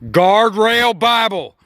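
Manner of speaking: high, loud, very slow show title; title announcement. Acted. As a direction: stretch it out like a grand opening.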